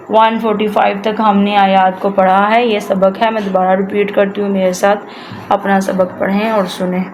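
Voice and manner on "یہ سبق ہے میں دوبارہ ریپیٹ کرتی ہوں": normal talking voice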